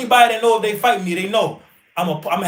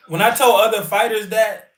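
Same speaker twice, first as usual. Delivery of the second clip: normal talking voice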